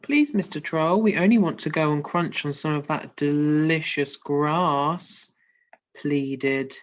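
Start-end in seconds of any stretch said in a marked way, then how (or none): 0.0-5.3 put-on pleading baby goat. Reading the goat's plea.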